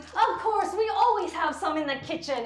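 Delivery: put-on a mom delighted to feed her boys; eager mother. Mother promising the son his favorite food, high and eager. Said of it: "your mom's like".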